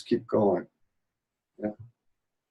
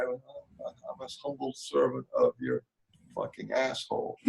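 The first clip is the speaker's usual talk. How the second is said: a hypocrite's false humility, muttered; mock humble servant; 12 dB quieter than usual. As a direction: fake humility that turns into a whispered insult. Mocking a falsely humble believer.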